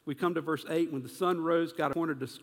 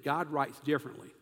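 ordinary delivery

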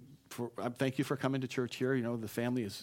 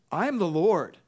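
higher, louder proclamation; divine declaration; 5 semitones higher, 7 dB louder than usual. Acted. God declaring himself to Moses, emphatic proclamation. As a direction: proclaim it as God's own words.

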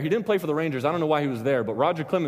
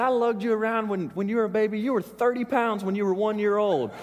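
high-pitched mimicry of a reproachful mother; mock guilt-trip in a raised voice; complaining mom; 7 semitones higher than usual. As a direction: play mom laying on the guilt. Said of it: "she used a new one on me".

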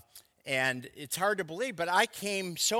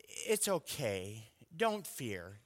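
soft, slow, much lower soothing voice; meek and mild; 6 semitones lower, 11 dB quieter, slower than usual. Shown as a demonstration. The mild version of God's command, soft and slow. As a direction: murmur it gently, no force. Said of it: "Instead of".

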